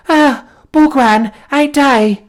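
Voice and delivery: put-on high, slow, gasping young woman's voice; dying girl gasping to her lover. A narrator's reading. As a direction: breathe it out as life fades. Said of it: "gasped the beautiful creature".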